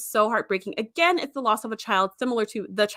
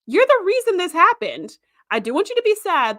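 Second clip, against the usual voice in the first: higher and louder, accusing the admiral; mock indignant outburst; 9 semitones higher, 4 dB louder than usual. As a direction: let it burst out in mock outrage at him. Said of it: "And I'm like".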